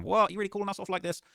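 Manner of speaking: high, loud mock disbelief from the crew; mock outrage. Commentator voicing the disappointed Crips, loud and high. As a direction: play the crew, stunned they got called off. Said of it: "They were saying".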